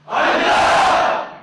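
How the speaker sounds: shouted reply. Real, not acted. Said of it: "목소리가 작다고".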